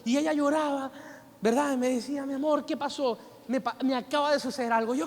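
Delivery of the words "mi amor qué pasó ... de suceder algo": ordinary talk, nothing marked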